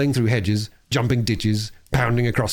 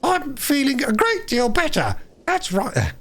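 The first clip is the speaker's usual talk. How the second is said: high, put-on old woman voice; washerwoman voice. A narrator's reading. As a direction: Toad playing a feeble lady. Toad disguised as washerwoman.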